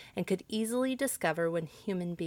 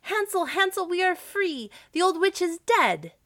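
high, loud shout of triumph; overjoyed child calling to her brother; joyful cry; 11 semitones higher, 7 dB louder than usual. A narrator's reading. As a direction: cry out the good news, pitched way up. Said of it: "and cried".

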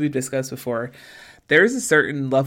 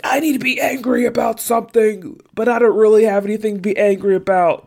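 higher, faster mock whine of an aggrieved guy; imitating Sean; 8 semitones higher, 4 dB louder than usual. Imitating Sean's pointless anger for a co-host, higher and hurried. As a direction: play him as a man itching for a fight. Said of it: "he's like, he's like".

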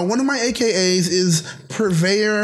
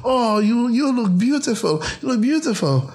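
high, sugary fake-sweet greeting; imitating the aunt's sugary welcome; 6 semitones higher than usual. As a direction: gush over her in a syrupy high voice. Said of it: "And she goes".

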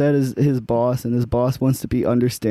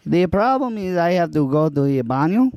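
light put-on Spanish accent. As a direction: say baño the Spanish way. Improv scene as Juan.

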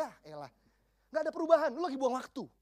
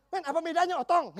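laughing; 6 semitones higher, 8 dB louder than usual. Real, not acted. Laughing at the boy's wish.

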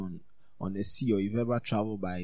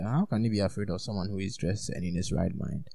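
ordinary delivery

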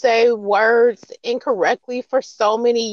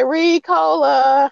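slow drawn-out Ricola; 6 semitones higher, 4 dB louder, much slower than usual. Shown as a demonstration. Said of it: "I say".

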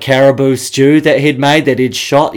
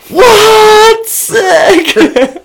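much higher and louder, stunned and thrilled; amazed; 6 dB louder than usual. Retold or imitated. Boys watching the cans explode, high amazed squeal. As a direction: squeal it like you can't believe it.

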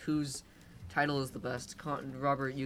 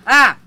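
sudden loud high scream; 8 semitones higher, 18 dB louder than usual. Real, not acted. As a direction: yell right at him. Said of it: "so I'm gonna scream at him".

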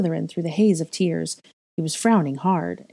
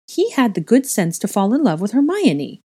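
raised, louder interjection; eager interjection; 5 semitones higher, 5 dB louder than usual. A narrator's reading. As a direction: jump in emphatically. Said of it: "but Helena jumped in".

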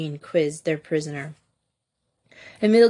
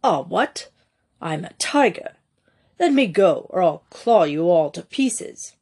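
rasped threat in clipped British; clipped British accent; 3 dB louder than usual. A narrator's reading. Captive threatening the girls holding him, clipped British rasp. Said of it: "In a clipped British accent, he rasped".

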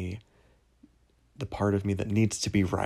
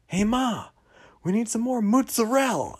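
loud, high-pitched mock Italian-American holler; 14 semitones higher, 6 dB louder than usual. Mocking Tony calling to his mother, hammed-up Italian-American shout. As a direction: yell for Ma in a hammed-up Jersey Italian accent. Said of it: "you do the thing where it's like".

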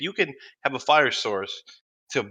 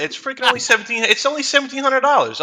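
surprised disbelief; 11 semitones higher than usual. Real, not acted. Finds the drum's price online.

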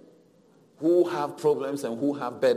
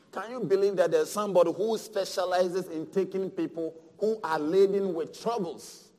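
normal talking voice

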